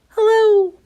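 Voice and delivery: very high little squeak; tiny voice. A narrator's reading. Mister Small greeting Mister Tall, a tiny high squeak.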